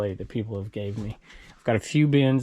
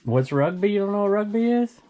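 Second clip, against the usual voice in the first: incredulous echo, rising and louder; surprised; 9 semitones higher, 4 dB louder than usual. Real, not acted. Dad amazed his kid doesn't know rugby, surprised disbelief.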